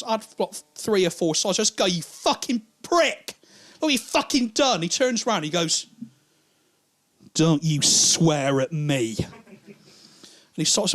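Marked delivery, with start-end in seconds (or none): 1.9-4.8 high, incredulous scolding; exasperated outburst. Retold or imitated. Comic confronting the drunk who hit him, exasperated. 7.3-9.1 slow, weighty warning; stern rebuke. Acted. Drunk man advancing on the comic, stern warning.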